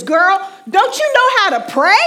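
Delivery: loud, high scolding grandmother; stern grandmother. Retold or imitated. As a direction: snap at her like a no-nonsense elder. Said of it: "She says".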